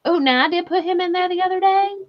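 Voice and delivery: ordinary talk, nothing marked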